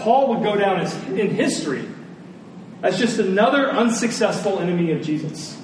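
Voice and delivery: ordinary talk, nothing marked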